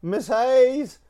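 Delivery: high, sing-song tattle to the teacher; tattling kid. Retold or imitated. Tim tattling to Miss Hayes about Scotty, mock sing-song. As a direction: play the snitch calling out to teacher. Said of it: "He goes".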